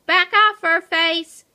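high, loud warning; feisty, forceful back-off yell; defiant shout. A narrator's reading. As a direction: shout the cat away. Hamster warns off mean cat.

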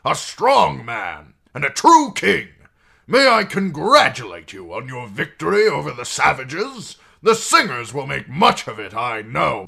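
high, loud, oily flattery; fawning courtier. Acted. Janos flatters the king.

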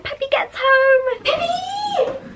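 very high-pitched delighted squeal; excited squeal. Real, not acted. Owner excited to have the dog home, excited squeal. Looking forward to greeting the dog.

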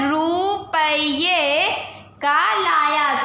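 रुपये stretched out slowly, syllable by syllable; slow reading aloud. Shown as a demonstration. Teacher reading a phrase for children, drawn out. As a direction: read it slowly for the class. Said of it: "तो क्या हो जाएगा?".

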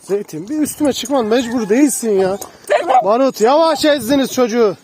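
loud, excited scolding of jumping dogs; laughing protest. Real, not acted. Owner fending off jumping dogs, loud and amused. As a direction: protest loudly but fondly.

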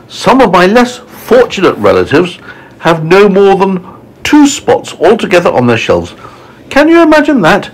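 high-pitched, snooty storybook lady; high, scandalised ladybird voice; disdainful ladybird. Acted. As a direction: gossip in a high voice about lesser relatives. Ladybird disparages her relatives.

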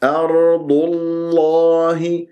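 slow chant, lips released for the l of الله; correct heavy lam. Shown as a demonstration. Teacher corrects lingering rounding in أرض الله, slow chant. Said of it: "ولا زالت شفاه مضمومة".

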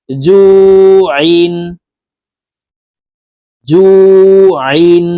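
জু held long, then আইন pressed from mid-throat; throat-pressed ayn. Shown as a demonstration. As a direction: squeeze the start of আইন in the middle of the throat after a long জু. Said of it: "হলকের মধ্যখান থেকে চাপ দিয়ে". (জু টেনে, আইন হলকের মাঝ থেকে চাপ দিয়ে)

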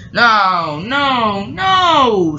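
emphatic protest. Acted. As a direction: protest harder on each no, stretching the last. Diesel cuts off the apology.

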